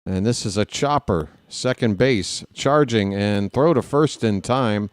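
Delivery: ordinary talk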